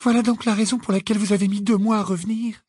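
high, rushed realisation; aghast. A narrator's reading. Hermione realises why Hagrid took months returning, aghast. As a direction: blurt it out high and appalled. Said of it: "dit Hermione effarée".

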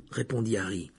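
ordinary delivery

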